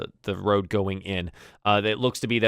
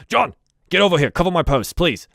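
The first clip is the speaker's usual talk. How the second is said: higher and louder, calling across the gate; guard summoning a fellow guard, raised voice; 8 semitones higher, 7 dB louder than usual. Acted. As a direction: shout an order over to John.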